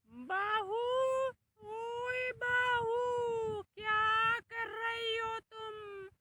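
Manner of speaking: drawn-out call to the daughter-in-law, "बहू" stretched slow; old mother-in-law calling. Acted.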